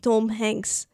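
put-on Russian accent. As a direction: echo it in mock Russian. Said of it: "do the Russian accent".

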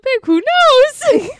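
high, loud mock outburst; imitating her delivery. Imitating a film character for laughs, high loud outburst. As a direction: belt it out, hammed-up and high. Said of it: "'Cause of the way she says it".

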